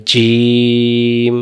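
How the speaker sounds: জিম stretched very long and slow; slow drawn-out jim. Shown as a demonstration. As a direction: draw out জিম slowly. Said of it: "অক্ষরের নাম বলেন". (জিম খুব টেনে ধীরে)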